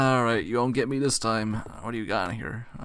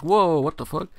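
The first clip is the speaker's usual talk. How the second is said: higher, louder mock startle; retold surprise; 6 semitones higher, 6 dB louder than usual. Gamer retelling his reaction to the fireplace spawn, mock surprise. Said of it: "I'm like".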